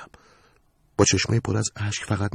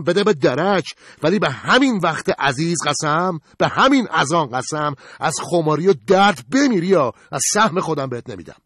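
angry vow; 9 semitones higher, 5 dB louder than usual. Acted. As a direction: swear it furiously. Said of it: "با عصبانیت بهش گفت". (قسم خوردن با خشم و صدای بلند)

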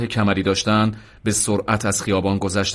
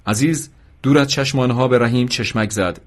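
usual speaking voice

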